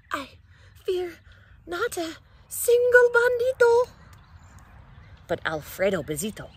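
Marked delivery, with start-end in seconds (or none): 0.1-3.9 gather courage mid-sentence; building bravado. Acted.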